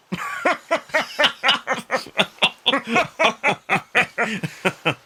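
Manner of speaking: loud rolling laugh on his back; long belly laugh. Acted. Wolf Amicus lies on the sand laughing, loud and long. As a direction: laugh helplessly. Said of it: "Amicus just lays there and laughs".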